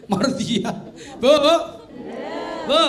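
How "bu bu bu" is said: loud laughing call. Real, not acted. Preacher calling the women in the audience, loud laugh. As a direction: call out to the ladies.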